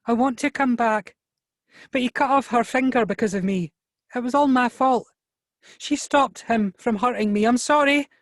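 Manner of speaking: high-pitched, distraught pleading; sobbing girl. A narrator's reading. Girl blames herself to Xavier for the injury, high sobbing. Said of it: "she sobbed".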